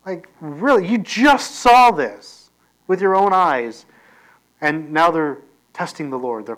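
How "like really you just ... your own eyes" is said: higher, louder, drawn-out incredulity; mock-scold them, stretching the words in disbelief; exasperated disbelief. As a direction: act appalled that they could doubt after seeing it. Preacher mock-scolds the doubting Israelites.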